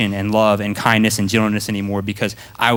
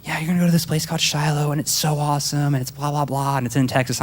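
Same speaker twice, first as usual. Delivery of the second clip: higher-pitched imitation of an eager mom; lightly mocked mom voice, pitched up; gushing mom; 5 semitones higher, 3 dB quieter than usual. Mom selling her kid on Shiloh, mock gushing. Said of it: "so my mom's like".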